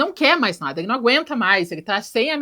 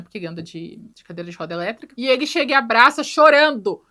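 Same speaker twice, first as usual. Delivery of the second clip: ordinary delivery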